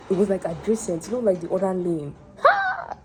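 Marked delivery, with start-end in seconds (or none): 2.4-3.0 very high, loud exclaimed "Ha!"; mock gasp. Driver retelling near miss in other lane, high mock gasp. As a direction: let out a staged high cry of shock.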